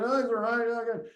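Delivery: a hint of a buddy giving a quick "all good"; friend's brush-off reply. Friend answering the call about his kid, lightly voiced breezy brush-off. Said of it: "We call our friends and".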